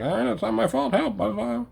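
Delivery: high, thin whimper of excuses; mocking whiny woman. Mimicking the woman who stepped in front, high helpless whine.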